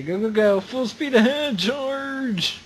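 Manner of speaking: rally the truck like a cavalry charge; rallying battle cry. Real, not acted.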